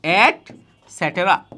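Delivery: "এটসেট্রা" said slowly in parts, et, set-ra; careful, broken-up "এটসেট্রা" as a full word; correct pronunciation. Shown as a demonstration. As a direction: model "এটসেট্রা" slowly for students. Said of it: "এটার উচ্চারণ হচ্ছে".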